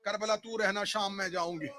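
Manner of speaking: soft plea. Acted.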